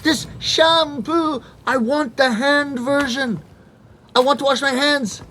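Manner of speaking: hammed-up frustrated customer; mock flustered self. Frustrated foreigner miming shampoo.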